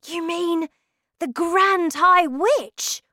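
put-on high, excited child's voice; astonished cry. A narrator's reading. Boy realises whom grandmother means, amazed high cry. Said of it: "I cried".

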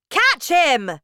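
high, loud witch's order; commanding shout. A narrator's reading. Grand High Witch sends witches after boy, loud shout. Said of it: "shouted the Grand High Witch".

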